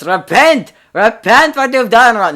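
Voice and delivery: high loud ranting yell; old man yelling. Acted. Retake: old man shouting at the teens, loud rant. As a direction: bellow like a street prophet. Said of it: "He starts yelling at us".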